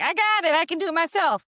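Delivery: defiant insistence. Retold or imitated. Coach imagining the client rebuffing her husband, high defiant protest. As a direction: insist you can manage alone. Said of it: "would you be like".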